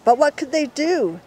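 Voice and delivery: ordinary delivery, nothing marked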